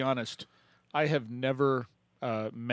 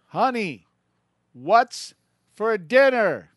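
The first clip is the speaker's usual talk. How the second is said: higher, louder call across the house; 10 semitones higher, 10 dB louder, much slower than usual. Retold or imitated. Husband moves closer and calls his wife louder. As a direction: call her again, pushing harder. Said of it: "Gets a little bit closer".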